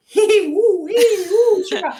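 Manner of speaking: high loud giggling whoops; giggly hyper kid. Retold or imitated. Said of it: "The kid's like".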